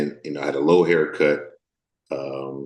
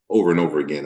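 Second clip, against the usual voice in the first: normal talking voice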